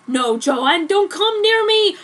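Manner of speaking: high, loud panicked scream. Acted. Edward fleeing Joan in the car park, loud panicked scream. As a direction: shriek to keep her away, hammed-up. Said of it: "he screamed and ran away".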